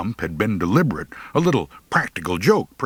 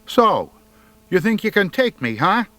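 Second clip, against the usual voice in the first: high, louder mock-tough challenge; tough bluff; 12 semitones higher, 4 dB louder than usual. A narrator's reading. Scared boy bluffing the bully, high and fast. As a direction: fake the bravado.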